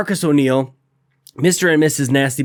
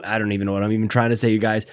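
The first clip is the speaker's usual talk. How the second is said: normal talking voice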